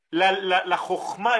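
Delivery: usual speaking voice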